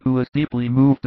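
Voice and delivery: ordinary delivery, nothing marked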